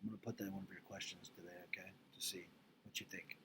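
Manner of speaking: soft, breathy aside to the class; whispered aside. Real, not acted. Teacher murmuring a note to her students, hushed. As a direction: drop the voice to a near whisper. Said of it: "teacher drops to whisper".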